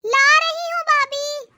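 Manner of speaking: slow, drawn-out shouted reply; calling back. Acted. Shifa answers her sister-in-law's order, calling back. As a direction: holler back, stretching the words.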